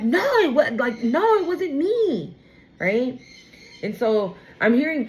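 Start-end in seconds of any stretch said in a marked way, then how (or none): 0.0-2.4 higher pleading protest; whiny denial. Retold or imitated. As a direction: plead your innocence. Acted denial, put-on voice.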